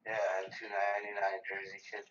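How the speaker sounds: ordinary talk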